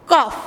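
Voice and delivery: "কফ" from the back of the tongue; teacher sounding out "কফ" alone; tongue-base letter sound. Shown as a demonstration. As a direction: press the tongue base to the palate for "কফ". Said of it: "জিভটা গোড়ার দিকে তালুর সঙ্গে লাগিয়ে উচ্চারণ করছি".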